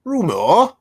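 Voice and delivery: exaggerated British accent, pitched high; mock British accent. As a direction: question it in a put-on British accent. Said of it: "spelled with an O U R".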